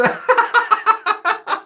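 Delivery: high-pitched giggling fit; real laughter. Real, not acted. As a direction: laugh helplessly in a high register. Amused by chat banter.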